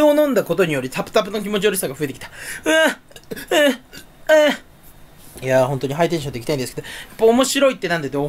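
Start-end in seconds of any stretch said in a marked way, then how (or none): 2.6-5.4 disgusted groan. Real, not acted. Nauseous after drinking water.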